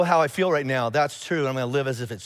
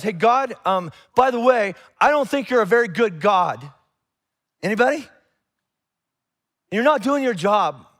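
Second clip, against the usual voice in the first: raised, pleading complaint to God; complaining prayer; 5 semitones higher, 5 dB louder, slower than usual. Retold or imitated. Pastor voicing his own frustrated prayer, raised and pleading. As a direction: let the hurt spill out at God, pitched up and louder. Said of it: "it looks back at God and goes".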